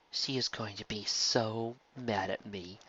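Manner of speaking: soft slow mournful voice; mourning. A narrator's reading. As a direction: moan it quietly in dread. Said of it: "Lyra's voice mourned".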